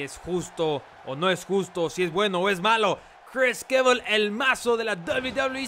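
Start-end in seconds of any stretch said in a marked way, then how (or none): 3.3-5.7 excited ringside commentator; play-by-play commentary. Acted. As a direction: call the match with energy. Live wrestling play-by-play.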